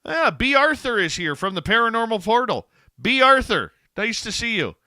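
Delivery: excited greeting. Real, not acted. Delighted greeting of guest.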